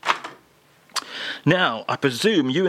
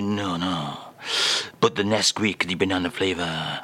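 mock French [name]; 8 semitones lower, 3 dB quieter than usual. [name] reads the French phrase to the host in a mock French accent.

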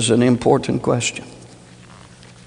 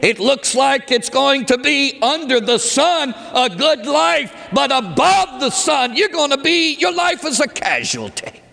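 high, ringing pulpit cry; preaching at full force; 14 semitones higher than usual. Acted. Preacher crying out to the congregation, high and urgent.

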